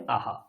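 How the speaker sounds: soft, drawn "আহা" showing the visarga echo; visarga sound aha. Shown as a demonstration. Teacher showing students the visarga sound, soft drawn aha. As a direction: breathe out a gentle "আহা". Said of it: "এইভাবে".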